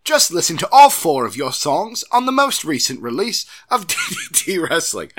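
loud, hammed-up English accent. Host reading a listener's email aloud, hammed-up English accent. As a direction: read it in a big stagey English accent. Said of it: "read this in an English accent".

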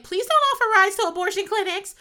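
loud, high pleading scold; exasperated plea. Retold or imitated. Glenn begging Jonah to stop, loud and high. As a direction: beg him to stop.

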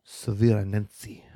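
slow, careful French accent on Severe Annecy. Shown as a demonstration. Asked by cohost to try a French accent, slow French accent. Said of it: "Try with a French accent".

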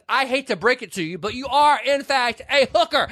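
high hammed-up Edward voice; man telling her what she is, high and hammy; mock Edward. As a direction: ham up the rich man's put-down in a high voice. Edward quoted in retold movie.

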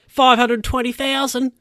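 high-pitched burst of astonishment; amazed. Real, not acted. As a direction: read the figure out in amazement, voice jumping high. Amazed at inflation calculator result.

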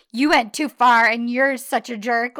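loud pretend telling-off; hammed-up loud complaint at a partner; playfully overdone outburst; mock scolding. Acting out scolding her husband.

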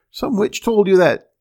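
a loud, enraged shout of disbelief; furious outcry. A narrator's reading. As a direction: yell it out in a fit of rage. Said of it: "cried the little man ... in a rage".

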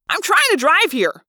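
loud, high-pitched complaint; irritated driver's outburst; exasperated demand. A narrator's reading. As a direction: protest it, harried and annoyed. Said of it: "I demanded".

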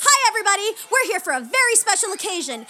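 put-on high, loud kids' party host; singsong puppet show host. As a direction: pump up the kids like a children's entertainer. Clown hosting kids' party.

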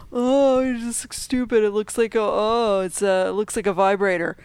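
lightly voiced dismissive online complainer; mock a petty troll's complaint, pitched a little up; mocking internet whiner. Fan imitating internet critics of the new screwdriver, mildly mocking. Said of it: "internet's gonna be a complete fucking dick".

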